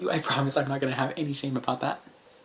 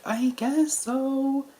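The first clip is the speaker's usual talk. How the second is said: drawn-out, high and puzzled; a slow, high, hesitant reply; unsure; 10 semitones higher, much slower than usual. Retold or imitated.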